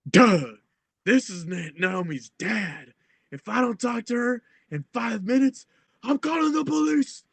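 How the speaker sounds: high, strained put-on dad threat; mock angry dad. Retelling her dad threatening over the phone, high mock-angry voice.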